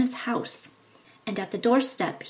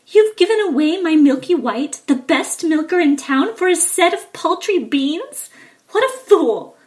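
higher, louder furious mother; angry scolding; 7 semitones higher, 10 dB louder than usual. Acted. Mother scolds Jack for trading the cow, angry. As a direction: scold him for the foolish trade. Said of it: "In anger".

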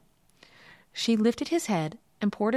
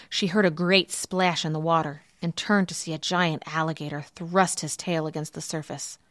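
usual speaking voice

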